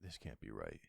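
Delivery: barely audible, almost under the breath; stunned near-silent disbelief. Acted. Dad murmuring in shock at grandma's gift. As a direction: say it to yourself, hardly voiced.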